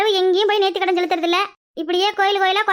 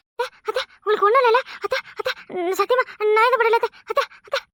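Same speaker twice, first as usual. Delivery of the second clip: higher, breathy cries from a bad dream; nightmare panic; 4 semitones higher than usual. Daughter-in-law calling to mother-in-law in a nightmare, panicked. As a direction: plead in fright, half asleep.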